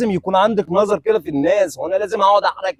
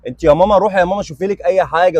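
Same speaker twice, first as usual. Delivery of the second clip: usual speaking voice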